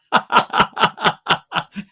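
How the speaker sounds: unvoiced wheezing laugh; breathless laugh. Real, not acted. Laughing at own joke.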